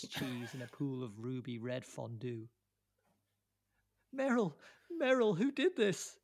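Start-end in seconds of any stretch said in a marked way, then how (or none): 4.1-6.2 high, loud anguished shout; anguished cry. A narrator's reading. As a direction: cry out over the body. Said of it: "cries Truman".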